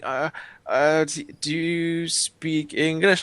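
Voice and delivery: slow, halting, high-pitched question; imitated unsure tourist, rising at the end; hesitant tourist. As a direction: stammer it out tentatively, stretching the words. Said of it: "they're asking in English but saying".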